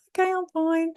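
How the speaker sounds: high, unconvincing brush-off; voice going up. Shown as a demonstration. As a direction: pitch it way up as if covering the truth. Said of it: "did you notice how my voice went up?".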